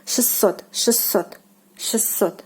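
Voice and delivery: шестьсот said "shes-SOT", the T dropped, two S; шестьсот with no T, a double S; T omitted. Shown as a demonstration. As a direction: model шестьсот without the T. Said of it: "pronounce it, like, with two S".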